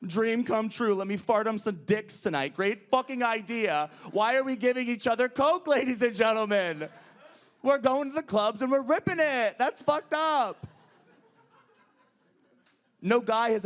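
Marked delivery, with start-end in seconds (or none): 0.1-10.7 higher, louder, slowing rant; rising campy outrage at the crowd; mock outrage. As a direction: ham up the disbelief. Ranting about Coke at clubs.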